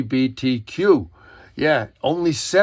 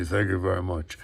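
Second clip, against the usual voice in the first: low, quieter celebrity catchphrase impression; 7 semitones lower, 7 dB quieter, faster than usual. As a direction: drop the voice low for the famous thank-you. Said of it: "as [name] would say".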